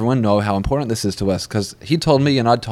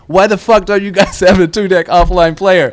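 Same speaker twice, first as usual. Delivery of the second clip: retold loud gripe, louder and higher; loud demanding complaint; 8 semitones higher, 7 dB louder than usual. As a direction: throw the question out loud and pushy. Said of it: "Zitra was like".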